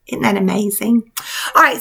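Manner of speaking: ordinary delivery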